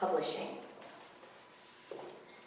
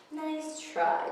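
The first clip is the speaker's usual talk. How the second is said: put-on amused kid; 10 semitones higher than usual.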